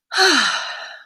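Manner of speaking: audible sigh between readings. Real, not acted. Reader pausing before the next passage, sighing. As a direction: let out one open sigh.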